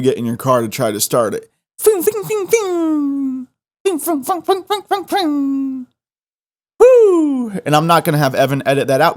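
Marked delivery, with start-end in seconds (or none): 1.8-5.9 very high repeated fing like a dead engine; car won't start noise. Real, not acted. Tic while describing a dead car battery, very high fing sounds. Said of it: "You know what y'all just witnessed there". 6.8-7.5 loud high woo; whoop. Real, not acted. Relieved after a tic burst, loud high whoop.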